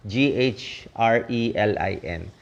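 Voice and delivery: slow letter-by-letter spelling of the hormone name; spelled out letter by letter. Shown as a demonstration. Doctor spelling "G H R E L I N" for viewers, slow and separate. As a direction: spell "G H R E L I N" slowly, one letter at a time. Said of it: "kung tawagin ay ghrelin".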